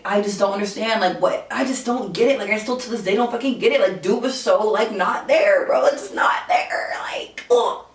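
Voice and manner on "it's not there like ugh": exasperated breathy whisper; worked up whisper. Real, not acted. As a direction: whisper it, fed up. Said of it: "I'm getting worked up right now".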